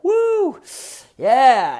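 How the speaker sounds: very high, loud whoop; spicy rush whoop. Shown as a demonstration. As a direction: whoop like the heat just hit. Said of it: "the spicy food ride".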